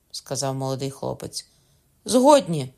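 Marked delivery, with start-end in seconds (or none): 2.0-2.7 high, loud assent; eager shout. A narrator's reading. Parents agreeing to save their son, shouting. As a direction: cry out agreement at once. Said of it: "крикнули Меркандон з жінкою".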